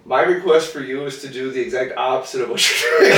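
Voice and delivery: talking through laughter; laughing. Real, not acted. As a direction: crack up mid-sentence.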